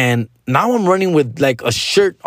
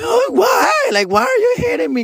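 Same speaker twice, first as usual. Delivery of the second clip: very high whimpering plea; imitating a sobbing twelve-year-old; crying kid. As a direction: plead like a hurt, crying child. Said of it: "I start crying ... And I'm just like".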